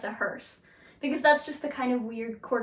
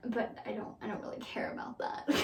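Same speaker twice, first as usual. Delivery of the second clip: whispered aside; 2 semitones lower, 12 dB quieter than usual. Real, not acted. Dismissive aside under breath.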